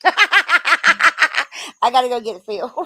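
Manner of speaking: real laughter, loud and high; laughing. Real, not acted. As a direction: laugh through the words at yourself. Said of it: "Can y'all tell I don't have my Adderall".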